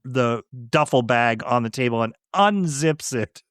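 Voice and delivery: normal talking voice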